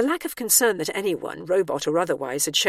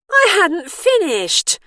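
high, loud, slow accusation; accusing; 13 semitones higher, 9 dB louder, much slower than usual. A narrator's reading. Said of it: "she said accusingly".

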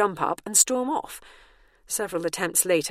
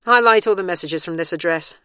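commanding; 8 dB louder than usual. Acted. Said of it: "she instructed the tablet".

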